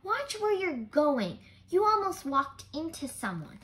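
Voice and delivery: urgent scolding. Acted. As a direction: tell her off out of worry. Scolding friend nearly bumping someone.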